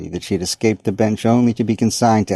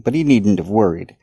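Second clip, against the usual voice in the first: ordinary delivery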